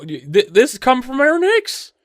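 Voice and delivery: high, stammering incredulity; can hardly believe who said it; amused disbelief. Real, not acted. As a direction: stumble in, pitch climbing with disbelief. Host incredulous after laughing.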